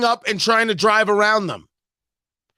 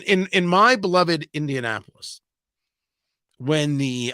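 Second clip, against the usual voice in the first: ordinary delivery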